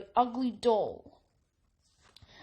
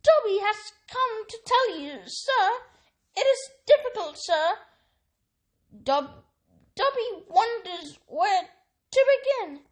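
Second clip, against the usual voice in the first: high, halting elf voice; a nervous elf struggling to start; anxious house elf; 8 semitones higher, 4 dB louder than usual. A narrator's reading. Elf tells Harry why he came, high and halting.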